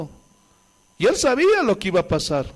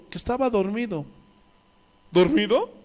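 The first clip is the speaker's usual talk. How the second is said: ordinary talk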